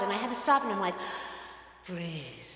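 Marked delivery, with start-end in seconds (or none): 1.9-2.4 much softer and lower, calming; meditative breath cue. Shown as a demonstration. Pastor calming herself mid-crisis, soft meditation voice. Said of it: "as we do in meditation".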